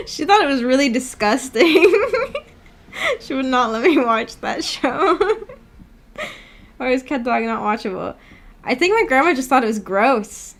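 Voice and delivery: ordinary talk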